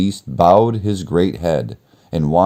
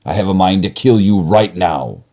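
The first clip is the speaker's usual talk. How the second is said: raised menacing monster voice; threatening beast; 4 semitones higher, 5 dB louder than usual. Acted. Beast threatening the kneeling merchant, menacing.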